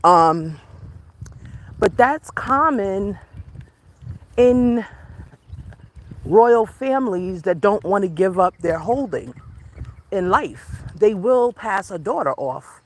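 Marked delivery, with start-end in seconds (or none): none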